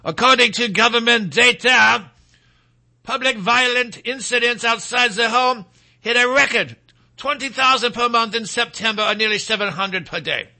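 put-on French broadcast voice; French broadcaster. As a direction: read it out like a French newsreader. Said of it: "in my, uh, French broadcaster's voice".

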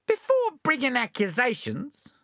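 high, slippery possum voice; sly possum voice. Acted. Possum argues.

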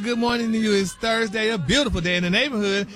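high, loud show-opening greeting; radio show greeting. Acted. Gossip reporter greets host and America, big announcer greeting. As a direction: belt out a big morning hello to the listeners. Opens a celebrity news segment.

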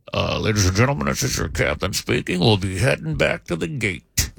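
a hint of the calm airline captain; pilot announcement. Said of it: "the pilot finally came on and said".

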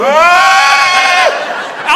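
long high loud scream; mock shark-panic scream; terrified scream. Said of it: "and I'm going".

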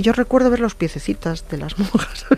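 ordinary talk, nothing marked

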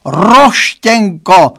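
drawn-out lament. A narrator's reading. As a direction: drag the name out hopelessly. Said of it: "zanaříkal".

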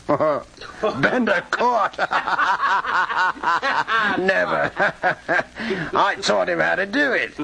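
high, helpless laughter through the words; laughing scoff. Acted. Grigsby scoffs at his pal getting caught, laughing.

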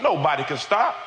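high, insistent preaching; full-force preaching. Real, not acted. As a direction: drive it home to the congregation.

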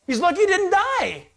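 high, bright amused outburst near a laugh; amused exclamation. Real, not acted. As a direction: toss it off with a chuckle. Joking about the shot trespasser.

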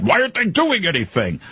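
high-pitched imitation of exasperated listeners; mock screaming. Host imitates audience screaming at their radios, mock outraged yelling. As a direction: yell like a fed-up listener at the radio. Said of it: "you're all screaming back at your radio".

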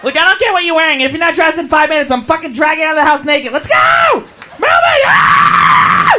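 bossy yelling friend. Acted. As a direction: shout them out the door. Organizer friend ordering everyone out.

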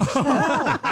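squealing laugh; cracking up; high burst of laughter. Real, not acted. Teller cracking up at his story.

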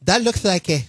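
put-on Castilian Spanish accent; mock a Barcelona fan's Castilian accent; lisping Castilian-accented English. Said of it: "in your Castilian accent".

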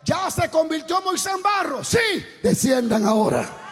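loud, high impassioned preaching over the music; preaching at full force. Acted. Preacher urging the congregation on, preaching at full force. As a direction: pour it out like a revival preacher.

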